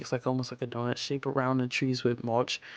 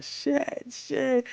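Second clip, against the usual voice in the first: mock yell; 13 semitones higher than usual. Retelling a coworker cursing over the unfilled tank, high mock yell. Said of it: "he just started yelling".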